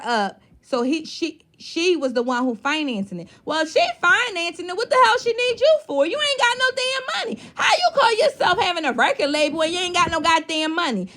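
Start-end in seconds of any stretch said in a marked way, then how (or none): 3.5-11.1 incredulous rant rising to a shout; building outraged rant. Real, not acted. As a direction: work yourself up until you're half laughing, half yelling. Ranting at the man's money claims.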